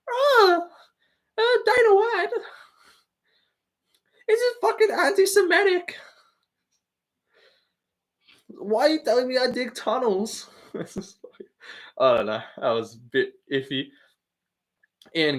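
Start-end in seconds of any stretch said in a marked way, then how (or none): 0.1-10.5 high whining voice, much higher; whiny fighter impression. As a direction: whine like a sulking fighter. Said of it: "It's gotta whine".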